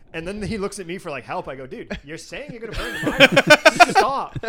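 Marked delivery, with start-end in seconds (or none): 1.7-4.4 loud, high exasperated laughing plea. Retold or imitated. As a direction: shout at a drunk cousin to quit it. Retold exasperated plea to cousin.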